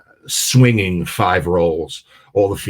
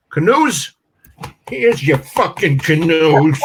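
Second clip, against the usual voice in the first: much higher, louder, slow Brooklyn punchline; defiant Brooklyn accent; 9 semitones higher, 6 dB louder, slower than usual. Retold or imitated. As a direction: spit out the punchline like a tough New Yorker. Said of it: "the guy from Brooklyn goes".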